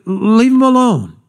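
high, drawn-out plea; slow it down and pitch it high, imitating a protest; high-pitched protest to a friend; acted rebuke. Criminal rebukes his friend.

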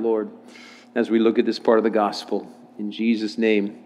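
low, soft praying voice; prayer. Acted. Leads a prayer.

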